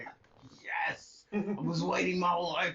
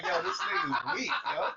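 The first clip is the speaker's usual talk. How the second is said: dismissive fan. Retold or imitated. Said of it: "It was like".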